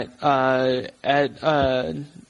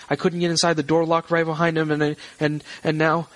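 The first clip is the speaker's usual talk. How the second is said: higher voice, rambling and growing panicked; distressed woman; 4 semitones higher, faster than usual. Acted. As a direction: stammer through it as a frantic wife. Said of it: "she seems distressed".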